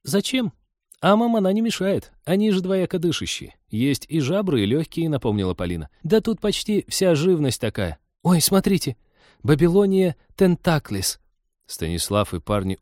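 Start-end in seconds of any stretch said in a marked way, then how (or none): 0.0-5.1 put-on very high girlish voice, matter-of-fact; high-pitched young know-it-all; enthusiastic girl naturalist. Voices Polina reminding in story. 6.0-11.2 put-on high, thrilled girlish voice; delighted high-pitched exclaiming; excited girl naturalist. A narrator's reading. Polina excitedly spots a creature.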